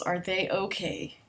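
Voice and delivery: usual speaking voice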